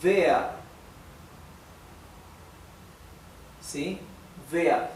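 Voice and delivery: Wer said as vair, v sound; Wer, W pronounced like V; German wer. Shown as a demonstration. Teacher models Wer for Spanish students, V sound. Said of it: "se pronunciaría algo parecido a esto".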